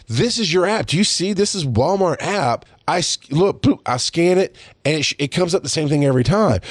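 high-pitched retold arguing; exasperated insistence. Retells insisting during the argument.

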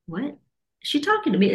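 high, incredulous question; startled at being asked to bless the teacher; surprised disbelief. Retold or imitated. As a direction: pitch it up in disbelief. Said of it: "And I'm like".

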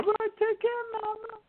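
put-on very high, girlish pitch. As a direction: squeak it up like a little girl. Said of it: "that's my high voice".

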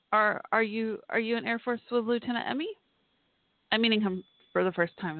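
normal talking voice

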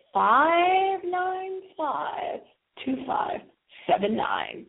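"five nine five" stretched out very slowly, high; slow dictation. Shown as a demonstration. Host dictates call-in number slowly for listeners. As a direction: draw out each number so listeners write. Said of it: "I'll give it to you again".

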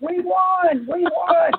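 high, drawn-out winning cheer; mock excited scream. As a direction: imitate a team cheering on command. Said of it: "Scream as loud as you can".

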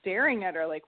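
usual speaking voice

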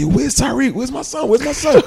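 very high-pitched, urgent questions; mock-frantic call for a missing child. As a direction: plead for him, voice way up high. Acting out looking for son.

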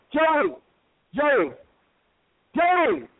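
high-pitched call for someone. Acted. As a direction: call his name, waiting between tries. Calling out for Joey to appear.

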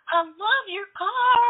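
high, loud, slow call out; excited call. Retold or imitated. Calling out the window to the stranger, high thrilled call. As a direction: gush across to the driver.

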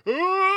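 high startled yell imitating Clarkson; mock scream. Clarkson screaming as the camper sways. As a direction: yelp in alarm like a man in a swaying camper. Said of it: "he'd be like".

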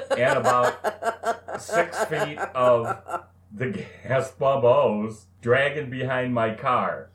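high, slow words broken by laughter; can barely get it out for laughing; laughing while telling. Real, not acted. To his co-host, telling the gas pump story, laughing through it. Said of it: "laughing through the story".

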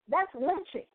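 ordinary delivery

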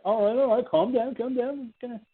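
calm him down like a sly policeman hiding a trap; shady cop. Retold or imitated. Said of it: "they're like".

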